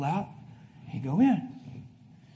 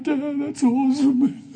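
mock casual; 10 semitones higher, 5 dB louder, slower than usual. Mock calm reply to dad.

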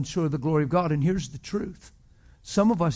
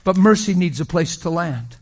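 loud, higher preaching cadence; full-force preaching; 5 semitones higher, 10 dB louder than usual. Real, not acted. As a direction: preach it hard to the crowd.

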